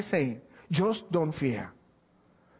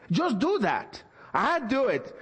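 raised, impassioned sermon voice; fervent preaching; 6 semitones higher than usual. Acted. Pastor exhorting the church with fervent preaching. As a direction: preach it with rising fire to the congregation.

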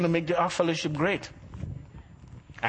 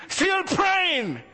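mock shout; 10 semitones higher than usual. Shouting to show his yelling.

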